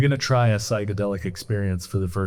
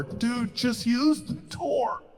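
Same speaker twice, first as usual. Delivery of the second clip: much higher, slow calling through a door; high friend voice; 13 semitones higher, 4 dB quieter, much slower than usual. Acted. Friends banging on bathroom door.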